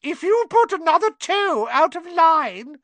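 loud screeching mock-mother voice; shrill, piercing. A narrator's reading. Malfoy mimicking Weasley's mother, shrill and piercing. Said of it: "He put on a shrill, piercing voice".

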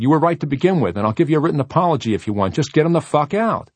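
urgent, high-pitched command to get it done; urgent order. A narrator's reading. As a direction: fire it off loudly under pressure. Tense order amid dog struggle.

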